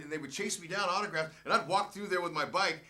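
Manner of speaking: normal talking voice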